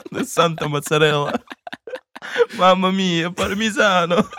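hammy Italian exclamation, slow and high; mock Italian accent. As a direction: ham it up like a cartoon Italian.

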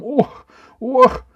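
two loud, high-pitched yelps; feigned spasm squeals; jolted cries. A narrator's reading. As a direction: cry out with each twitch. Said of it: "И снова дёрнулся ещё сильнее".

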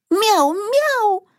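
very high repeated kitten mews; squeaky meow. Acted. Kitten mews again from the sack, high squeak. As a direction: mew twice in a tiny high voice. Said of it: "пискнул мешок".

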